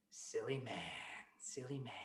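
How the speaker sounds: low, very soft, drawn-out taunt; hushed storybook gloating; quiet mocking. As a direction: murmur it slyly, stretching the words. Mice mock the greedy man.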